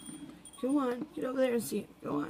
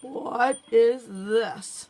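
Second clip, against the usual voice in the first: pet's voice; 8 dB louder, much slower than usual. Retold or imitated. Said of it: "Babe's going".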